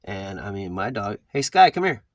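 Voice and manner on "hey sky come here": loud, high call to the dog. Real, not acted. Calling his dog Sky back to him, loud and high. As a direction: call her over from across the yard.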